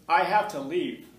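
loud, slow imitated drawl; student insisting he must leave, John Wayne style. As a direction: stretch it out like an old cowboy. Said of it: "he talked like John Wayne".

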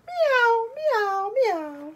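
high meows stepping down; high-pitched cat call. Acted. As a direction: meow high, then let each fall. Jazz cat character meows.

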